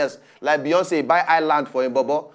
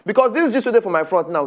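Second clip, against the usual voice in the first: usual speaking voice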